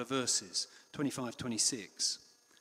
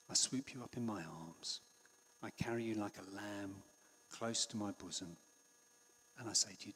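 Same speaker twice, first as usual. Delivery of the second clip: tender reassurance; 5 semitones lower, 9 dB quieter than usual. Retold or imitated. Jesus comforting a worried listener, tender and soft. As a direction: whisper it like holding a lamb close. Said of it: "I think that's the tone of voice".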